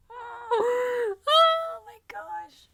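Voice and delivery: high, thin, near-tearful gush; overwhelmed squeal. Retold or imitated. Fan reacting to both characters being nervous, tearful squeal. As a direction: squeak it out, melting.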